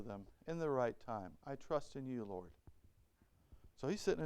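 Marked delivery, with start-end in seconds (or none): 1.5-2.5 quiet prayer. Retold or imitated.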